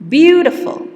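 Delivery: "beautiful" high and loud with BEAU-tiful stressed. Shown as a demonstration. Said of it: "also on the first syllable".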